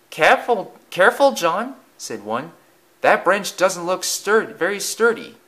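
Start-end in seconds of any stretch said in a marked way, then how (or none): 0.1-1.8 high, louder warning; worried boy. A narrator's reading. Boy warns friend in tree. 3.0-5.4 put-on doubt it in a high boyish voice; worried boy. A narrator's reading. Boy warns about the branch.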